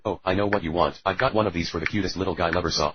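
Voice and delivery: normal talking voice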